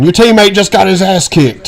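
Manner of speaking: loud, high game announcer; booming video game announcer. Retold or imitated. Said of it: "Random announcer comes in".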